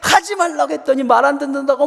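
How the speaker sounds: acted-out mother telling off her child; angry mother. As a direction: scold like an exasperated mom. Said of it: "막 화를 내시는 거예요".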